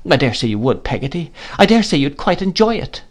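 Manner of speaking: tearful reproach. A narrator's reading. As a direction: scold through tears. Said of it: "shedding more tears".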